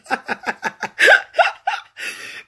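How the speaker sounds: high-pitched burst of laughter; giggling at a viewer. Real, not acted. Laughing at a comment.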